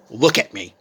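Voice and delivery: mother ordering her daughter to look at her, stern and loud; stern command. Retold or imitated. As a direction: snap it out like a fed-up mother. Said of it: "and Mom's like".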